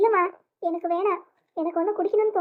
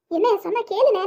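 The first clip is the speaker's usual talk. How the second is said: raised, alarmed appeal; urgent pleading; 4 semitones higher, 3 dB louder than usual. Acted. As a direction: implore brother to listen before he strikes. Sister restrains brother with bat. (பதற்றத்தோடு கெஞ்சல்)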